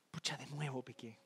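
dilo casi para ti, muy bajo y apagado; murmullo avergonzado. Retold or imitated. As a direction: suspiro culpable entre dientes, suave. Pecador avergonzado gime para sí. (pecador avergonzado se lamenta en voz muy baja)

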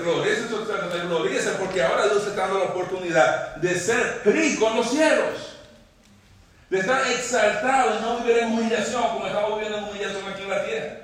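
high, loud sermon delivery; impassioned preaching. Acted. Preacher exhorting the church to glory in eternity, impassioned.

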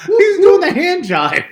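high, slow laughing words. Real, not acted. Laughing at screen.

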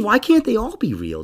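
usual speaking voice

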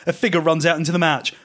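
ordinary delivery

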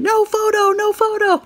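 high, loud mock shout of "No photo"; imitating guards shouting. Security guards yelling at tourists, shrill mock shout. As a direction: bark it like a guard stopping cameras. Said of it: "the security guards are just screaming".

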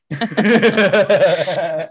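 high, loud burst of laughing; loud laughter. Real, not acted. Big laugh.

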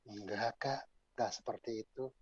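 low, very soft imitation of a teen; subdued reply. Teen girl quietly denies it to her counselor, soft and low. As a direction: drop to a small, quiet voice.

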